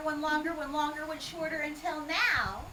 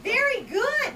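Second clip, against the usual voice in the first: hammed-up praise; 8 semitones higher, 6 dB louder, slower than usual. Praising volunteer's answer.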